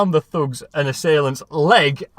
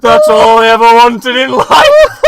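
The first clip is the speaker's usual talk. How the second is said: louder, slow, hammed-up tearful wail; mock sobbing cry; 6 semitones higher, 10 dB louder, slower than usual. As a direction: overdo a weepy confession. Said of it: "he starts to cry and says".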